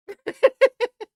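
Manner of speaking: loud cackle; loud high laugh. Real, not acted. Laughing loudly at the chat. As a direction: let a big laugh out.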